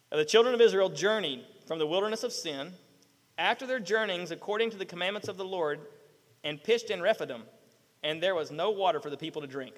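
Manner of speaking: low, measured Bible reading; scripture reading. Acted. Preacher reads Exodus to the congregation, sober reading. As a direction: read the verse out soberly, low and soft.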